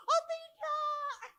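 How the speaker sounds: very high, stretched-out mock cry; mock horrified refusal. Pastor imagining refusing the mourner's hug, comic high cry. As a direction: squeal it slowly in comic dread. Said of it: "saya bilang".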